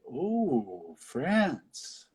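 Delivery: playful crowd imitation; mock impressed crowd. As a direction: swoon like a won-over town. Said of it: "the whole crowd does, like, this one eighty".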